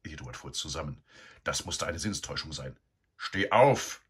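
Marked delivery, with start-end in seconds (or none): 3.2-4.0 much louder, higher harsh command; louder and harsher. A narrator's reading. Sow waking Eduard again, louder and harsher. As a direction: bark it harder this time. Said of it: "viel lauter und barscher als das erste Mal".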